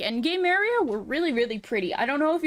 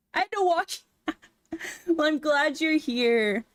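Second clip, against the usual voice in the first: usual speaking voice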